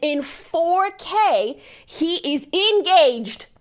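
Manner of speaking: slow, high, loud stress on "four K" and "engaged"; emphatic outrage. Real, not acted. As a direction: hammer "four K" and "engaged", stretched out. Hammering home the scandal.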